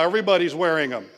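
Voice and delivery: usual speaking voice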